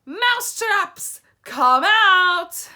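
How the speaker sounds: huge, high shriek; shrieking witch command; witch shout. A narrator's reading. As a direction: scream it at the hall of witches. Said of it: "raised her voice louder than ever and shouted".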